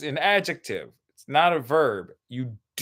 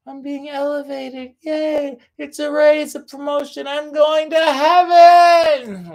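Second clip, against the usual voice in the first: high, loud, slowing elated voice of a rising elevator; joyful elevator; 10 semitones higher, 8 dB louder, slower than usual. Pretend. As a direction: sing it out like you're ascending to heaven. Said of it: "an elevator is".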